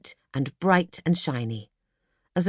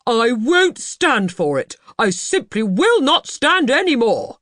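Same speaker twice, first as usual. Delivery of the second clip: slow, much louder and higher furious shout; 8 semitones higher, 7 dB louder, slower than usual. Acted. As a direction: explode with rage, word by word. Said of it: "shouted Mister Banks".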